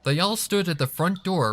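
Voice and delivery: ordinary delivery, nothing marked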